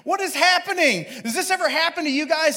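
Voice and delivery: high-pitched, delighted disbelief; giddy showing-off to the neighbors; amused kid. Retold or imitated. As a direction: imitate a boy thrilled by his own mishap. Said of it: "he thought it was hilarious".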